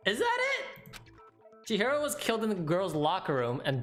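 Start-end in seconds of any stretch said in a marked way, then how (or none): 0.1-0.7 high stunned disbelief. Real, not acted. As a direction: squeak it out in shock. Shocked realization.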